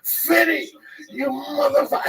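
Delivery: high-pitched mock outrage at a cat; pained cursing. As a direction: act out cursing the cat in pain. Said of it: "his claws would go into my".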